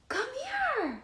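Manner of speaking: gasp, then a very high drawn-out coo. Real, not acted. Coaxing again after a gasp.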